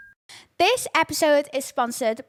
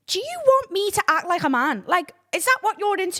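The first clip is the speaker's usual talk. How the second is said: louder, higher, indignant; indignant high rant; 8 semitones higher, 4 dB louder than usual. Real, not acted. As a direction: fire back at the trolls. Ranting back at male trolls.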